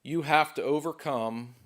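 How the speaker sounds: building from firm to loud and high; rising to a shout. Real, not acted. Speaker building to climax.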